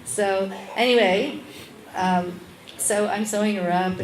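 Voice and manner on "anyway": ordinary delivery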